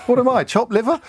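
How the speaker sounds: mock indignation. Presenter mock-indignant about fan texts.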